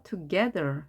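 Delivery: together with GE punched; "together", weak first syllable, strong GE; stress on second syllable. Shown as a demonstration. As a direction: lean on the middle syllable of "together". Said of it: "A에 힘이 생겨서".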